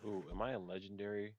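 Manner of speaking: normal talking voice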